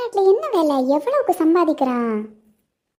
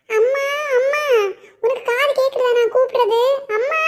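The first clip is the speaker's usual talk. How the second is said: calling out; 8 semitones higher than usual. Acted. Daughter calling mother from afar.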